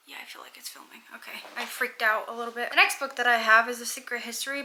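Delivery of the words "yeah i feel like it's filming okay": hushed, unvoiced whisper, a bit rushed; real whisper. Real, not acted. Unsure if the camera is rolling, she whispers. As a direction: whisper it so nobody hears.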